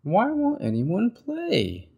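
high, slow, whiny wail; frustrated Bird. Acted. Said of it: "said Bird".

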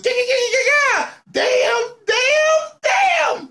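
high crying yeahs and damns; anguished wail. Real, not acted. Fan wailing over the loss.

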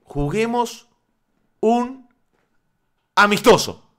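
slow, spaced out, word by word with long pauses; deliberately slow, pausing between each word; said slowly. Shown as a demonstration. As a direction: spell it out slowly so nobody gets upset. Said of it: "Lo voy a decir despacio".

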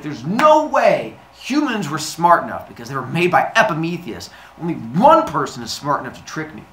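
emphatic, offended reasoning; indignant. Acted. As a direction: work it out in mock outrage. Zeus realizes he's tricked.

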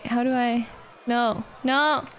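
normal talking voice

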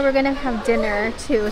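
ordinary delivery

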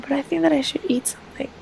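fast whisper to herself; queasy girl talking to herself; whispered aside. Acted. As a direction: breathe it under the breath. Sick child talks to herself.

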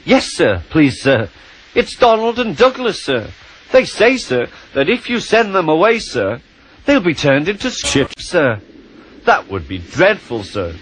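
high, stammering and timid; nervous small engine. Acted. Percy nervously pleading to the Fat Controller, high and timid. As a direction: stumble over the yeses, scared of the boss.

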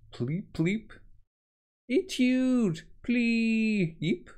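high squeaky creature chirps; creature squeaks. Acted. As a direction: squeak like a tiny critter. Imitating creature noise.

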